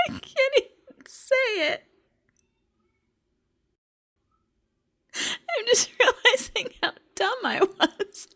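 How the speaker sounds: high, breaking with laughter; laughing so hard it turns to tears; laugh-crying. Real, not acted. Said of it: "I can't stop crying now".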